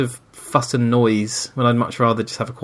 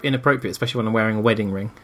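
ordinary talk, nothing marked

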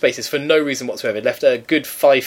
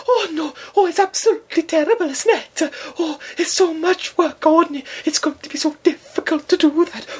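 high fussing Welsh landlady voice, much higher; huffy puffy Welsh lady. Retold or imitated. Landlady frets about the work, high and fussy. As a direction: flutter and fuss like a put-upon old landlady. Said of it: "She's a huffy puffy Welsh lady".

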